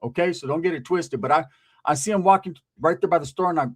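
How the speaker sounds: normal talking voice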